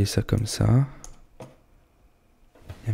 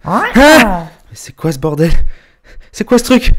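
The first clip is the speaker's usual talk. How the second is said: panicked shout; 11 semitones higher, 11 dB louder than usual. Acted. Panicked at a strange noise.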